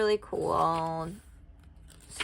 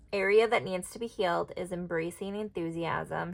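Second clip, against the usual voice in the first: ordinary talk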